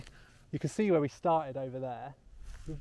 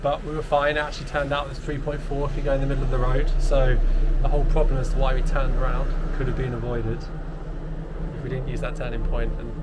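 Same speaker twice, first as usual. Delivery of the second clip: excited; 9 dB louder than usual. Real, not acted. Relieved laughing loud recap.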